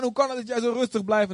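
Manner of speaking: high, stunned voice of the astonished man; mock amazed high-pitched question; high amazed imitation. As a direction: repeat his disbelief in a high squeak. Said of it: "en dan had ik echt al lang gedaan".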